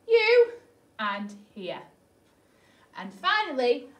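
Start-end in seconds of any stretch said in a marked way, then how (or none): 0.1-0.5 YOU lifted high and loud as a question. Acted. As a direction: turn YOU into a bright, rising question. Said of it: "you" is a question".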